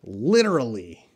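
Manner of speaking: "Literally" said as a model word, falling at the end; careful pronunciation. Shown as a demonstration. Teacher shows a viewer how to pronounce it, careful "Literally". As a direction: pronounce "Literally" clearly for a learner. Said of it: "How do you pronounce literally".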